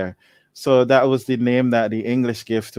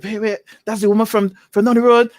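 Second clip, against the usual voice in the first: mock gossiping neighbour; 8 semitones higher, 4 dB louder, faster than usual.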